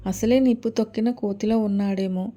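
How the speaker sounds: ordinary delivery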